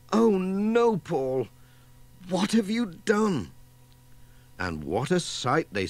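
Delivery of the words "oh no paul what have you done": high, startled cry of dismay; dismayed mother. Acted. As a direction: catch the baby making a mess. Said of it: "they could hear Missus Pottage saying".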